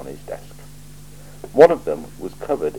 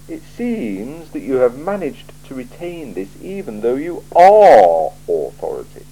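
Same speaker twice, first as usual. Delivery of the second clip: slow, higher, measured patrician voice; a cool ruler weighing every word; icy, deliberate interrogator; Lord Vetinari; 8 semitones higher, 9 dB louder than usual. Acted. Vetinari dry reproach.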